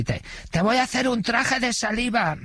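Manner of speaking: very high imitation of a heckling woman inmate; rowdy catcall. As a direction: mimic an audience woman's high-pitched catcall. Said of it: "nada más salir a la escena". (piropo descarado imitado, en voz muy aguda)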